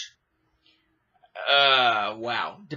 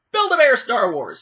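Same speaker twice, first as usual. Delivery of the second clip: mock ad pitch; 7 semitones higher, 7 dB louder than usual. Real, not acted. Pitch to sponsor.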